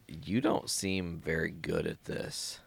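low, slow, soft deadpan; mock deadpan from the rescued guy; deadpan put-down. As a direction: drop it flat and unimpressed. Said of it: "the guy he saves is like".

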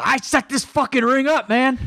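high, squeaky mock-indignant yell; imitating angry outburst. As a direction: squeal it out like an offended little guy. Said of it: "He got so angry".

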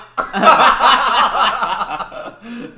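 high, loud burst of laughter; laughing hard at the fake spoiler. Real, not acted. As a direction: howl with real laughter.

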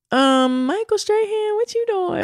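high-pitched call of mock outrage; mock disbelief. Co-host calls out an underpaid TV star, mock disbelief. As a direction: pitch it way up, calling someone out. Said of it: "I was like".

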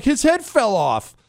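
high, slow, dumb-guy answer; dopey movie character. Retold or imitated. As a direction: say it like a clueless idiot. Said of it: "he's like".

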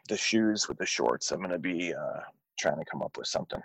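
ordinary talk

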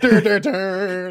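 slow, high goofy "dur" sounds; silly fighting noises. Acted. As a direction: imitate a daft cartoon duel in drawn-out noises. Mimics characters fighting with their chins.

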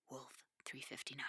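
hushed eerie whisper; spooky whisper. Acted. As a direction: breathe the title like a ghost story. Naming their spooky audio drama.

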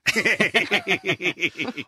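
high giggling laugh; burst of laughter. Real, not acted. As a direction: laugh at her answer. Laughs at moist answer.

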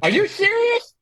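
high-pitched lisping question; mimicked lisp. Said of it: "saying it just like him".

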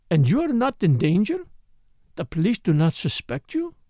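a hint of French, admiring and conspiratorial; lightly French-accented Morel. Morel's voice held.

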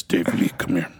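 a mild Danish accent imitation. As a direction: call him over in a Danish accent. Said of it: "He's got this Danish accent".

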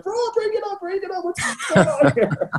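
very high, squawky mock alarm; hammed-up pleading, breaking into laughter at the end; panicked coach imitation. Imitates coach rushing to break up fight.

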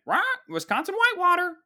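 very high squawking bird; hammed-up mechanical macaw; animatronic macaw. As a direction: squawk the name like a parrot. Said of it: "A big animatronic macaw".

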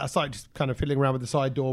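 ordinary delivery

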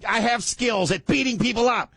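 high, shouty bully wife; bossy woman voice. Acted. Acting the wife.

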